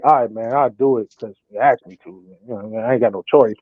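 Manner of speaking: low, resigned grudging mumble; unexcited reluctance. Retold or imitated. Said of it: "you ain't gonna be excited about it".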